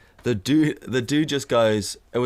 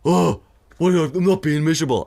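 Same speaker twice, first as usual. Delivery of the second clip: indignant protest; 6 semitones higher, 5 dB louder than usual. Retold or imitated. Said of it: "And he's like".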